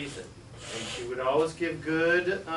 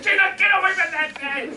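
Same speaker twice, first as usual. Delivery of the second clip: high, loud, urgent plea; alarmed warning; 8 dB louder than usual. Retold or imitated. Scared little brother shouting at Gina to get away. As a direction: yank her back with your voice. Boy warns his sister.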